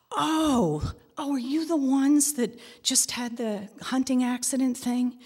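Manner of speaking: high, bright clerk voice lit up with recognition; excited recognition. Retold or imitated. Post office clerk recognizing the family from TV, excited. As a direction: gush like a clerk spotting a celebrity. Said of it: "post office clerk recognizes them".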